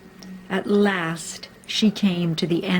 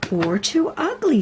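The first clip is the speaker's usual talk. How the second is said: much higher small animal voice; squeaky little hare; 9 semitones higher than usual. A narrator's reading. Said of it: "said Hare".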